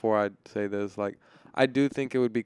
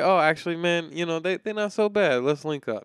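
higher, playful imitation; high mock voice; 7 semitones higher, 4 dB louder than usual. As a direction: voice a friendly acquaintance. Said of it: "it's like".

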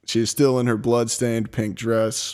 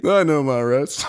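cool southern voice; 3 semitones higher than usual. Pretend. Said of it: "this guy's actually super cool".

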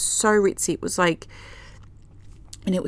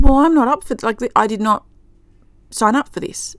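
raised, louder protest at the family; retold loud refusal; 4 semitones higher, 7 dB louder than usual. Telling her dream family loudly she won't go along. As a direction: push back hard, voice up.

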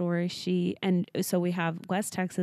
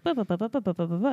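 rapid-fire babble of nonsense syllables; fast talker; faster than usual. Retold or imitated. Imitating mom repeating the news, rapid babble. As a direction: rattle off syllables like a rushed update. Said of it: "was going through that so fast".

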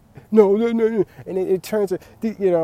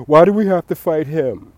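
higher, louder whiny objection; mock whiny complaint; 6 semitones higher, 10 dB louder than usual. Parent griping at the Golden Gloves, mock whiny and loud. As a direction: whine it like a sore loser. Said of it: "and they be like".

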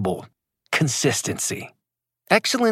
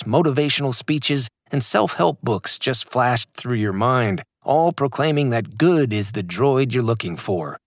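normal talking voice

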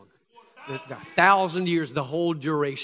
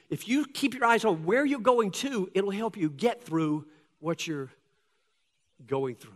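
normal talking voice

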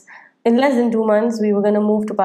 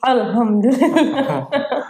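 Alhamdulillah drawn out slowly, Arabic style; mock Arabic accent; 3 semitones higher, much slower than usual. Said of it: "how do you say it?".

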